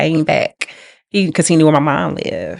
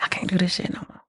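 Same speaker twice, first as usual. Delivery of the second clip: whispered, almost voiceless retelling; hushed private resolve; 12 dB quieter, faster than usual. Retold or imitated. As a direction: say it very softly, as if to yourself. Quotes own fed-up private resolve.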